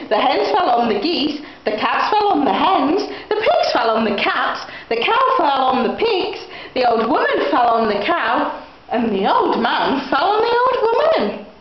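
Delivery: ordinary delivery, nothing marked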